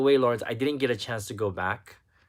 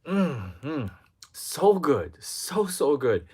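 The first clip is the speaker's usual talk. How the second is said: savoring; 4 semitones higher, 5 dB louder, slower than usual. Real, not acted. Savoring the remembered fries.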